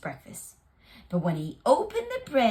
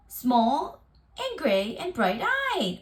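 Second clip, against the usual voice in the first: plain reading voice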